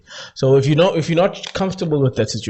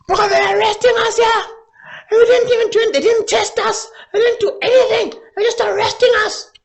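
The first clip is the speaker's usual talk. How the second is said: high, loud, crying falsetto; hammed-up high wailing girl voice; mock crying sister; 4 dB louder than usual. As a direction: whine and sob it like the sister. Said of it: "She's like".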